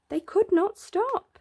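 ordinary delivery

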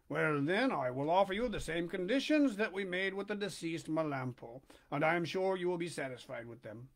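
steady storybook reading voice; story narration. A narrator's reading. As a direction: read it to the listeners like a bedtime chapter. Reads Pinocchio aloud.

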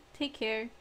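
ordinary talk, nothing marked

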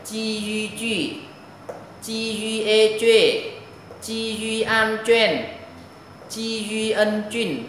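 đánh vần chậm rãi; slow spelling drill. Shown as a demonstration. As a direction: chant the spelling for pupils to copy. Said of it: "Đọc lại".

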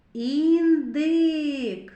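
"Індик" loud with both vowels held; vowels drawn out. Shown as a demonstration. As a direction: stretch the "и" in "Індик". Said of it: "зробимо звуковий аналіз слова".

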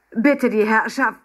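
slow, loud, high formal announcement; waiter announcing. A narrator's reading. Waiter arrives with laden trays, announcing grandly. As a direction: present the dishes with ceremony.

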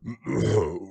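grand throat-clearing; impressive cough. Acted. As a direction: clear the throat importantly before a speech. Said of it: "coughed in an impressive way".

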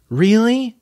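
high, loud astonished question; surprised little cub. Acted. Little tiger asks his father, amazed. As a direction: gasp it out amazed. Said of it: "asked the little tiger".

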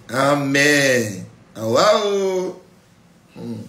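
mock amazement.

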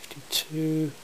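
ordinary talk, nothing marked

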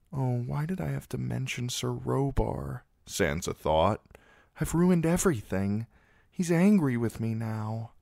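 put-on a girl scolding herself inwardly; dismayed girl. A narrator's reading. Sansa regrets mentioning Sir Robar, lightly anguished.

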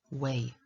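"Weigh" left open, with no T at the end; no final T. Shown as a demonstration. Teacher showing learners "Weigh" without a final T. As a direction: drop any T after "Weigh". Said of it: "nie wymawiamy tego T na końcu".

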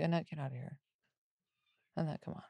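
low, very soft mock brush-off; dismissive aside. Daughter brushing off mom's nosy friend, soft dismissive murmur. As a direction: wave it away under your breath. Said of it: "I'd be like".

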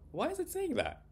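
very high-pitched, startled question; taken aback, voice shooting up. Acted. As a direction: ask in high-voiced disbelief. Startled narrator reacts to parrot.

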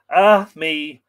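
anguished outcry, loud; cry of anguish. Acted. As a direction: let it out as heartfelt anguish. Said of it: "cried Fionn in anguish".

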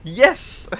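high, loud victory shout; triumphant yell. Real, not acted. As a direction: scream it out in triumph. Boss finally beaten.

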